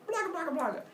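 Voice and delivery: high gibberish grunts; silly high monster noises; monster babble. Acted. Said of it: "And the monsters go".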